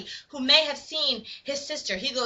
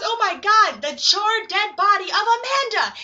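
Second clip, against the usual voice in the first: loud, higher-pitched mock horror; horrified discovery; 7 semitones higher, 6 dB louder than usual. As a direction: exclaim over a grisly find.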